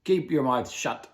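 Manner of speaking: put-on captain silencing the girl; snapped order. A narrator's reading. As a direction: bite the words off at her. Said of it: "snapped old Gus".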